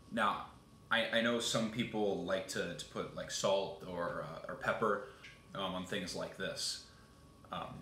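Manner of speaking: chewing between words; speaking after a bite; talking with mouth full. Real, not acted. Seen 0: Just bit potato; holds it, mouth moving while talking.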